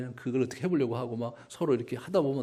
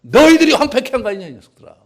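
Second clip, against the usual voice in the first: accusing yell; 10 semitones higher, 16 dB louder than usual. Pretend. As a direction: accuse loudly, then drop it. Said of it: "이렇게 얘기하신 거예요".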